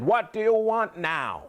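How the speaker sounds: high, loud impatient demand; loud, high old-woman complaint; old wise woman, irritated. Acted. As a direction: call out crossly at the returning visitor. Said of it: "and she said".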